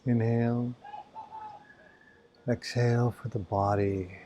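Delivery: calm, even voice with long silences; spacious, soothing meditation delivery; guided meditation. Acted. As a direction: guide their awareness through the body, leaving space. Leads body-awareness meditation.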